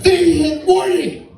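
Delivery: high, drawn-out mock yell; alarmed cry. Acted alarmed cry in retold brawl.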